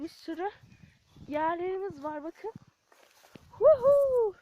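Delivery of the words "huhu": high gleeful whoop while flying. Shown as a demonstration. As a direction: whoop like you're taking off. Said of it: "Diye uçabilirsin".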